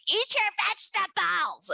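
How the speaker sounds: high, insistent mother voice; a hint of a nagging mom; mother's nagging. Mother ordering her daughter to eat vegetables, insistent. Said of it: "my mother going".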